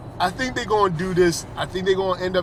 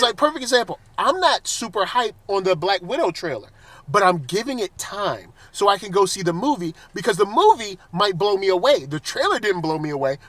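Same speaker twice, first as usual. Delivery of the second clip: usual speaking voice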